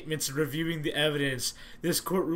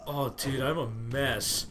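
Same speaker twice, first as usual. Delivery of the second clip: ordinary talk, nothing marked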